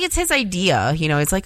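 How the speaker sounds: ordinary talk, nothing marked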